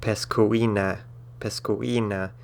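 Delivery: pescuina in four syllables, pes-koo-EE-na; broken diphthong. Shown as a demonstration. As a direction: keep u and i of pescuina apart, stressing the EE. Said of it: "be broken by an accent mark".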